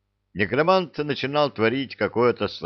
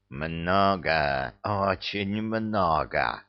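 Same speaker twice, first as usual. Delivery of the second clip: slow, sinister hissing villain; 3 semitones lower, 3 dB quieter, much slower than usual. A narrator's reading. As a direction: hiss it out with menace. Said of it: "зловеще прошипел новый противник". (зловещее шипение)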